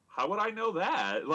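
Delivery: high-pitched indignant retort, played up; acting out a defensive protest; defensive dad. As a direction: throw it back in mock indignation. Dad's indignant retort in retold argument.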